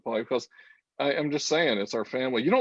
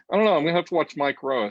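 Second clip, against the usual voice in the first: ordinary talk, nothing marked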